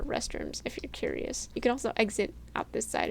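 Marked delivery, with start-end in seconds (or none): 0.0-1.5 soft throwaway whisper; whispered aside. Real, not acted. Aside about the restrooms.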